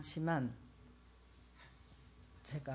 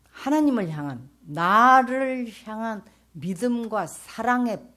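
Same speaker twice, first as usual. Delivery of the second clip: God's solemn answer; 3 semitones higher, 8 dB louder than usual. Retold or imitated. Speaker voices God's answer.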